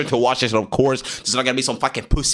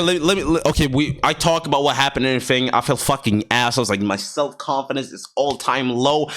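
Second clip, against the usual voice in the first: ordinary talk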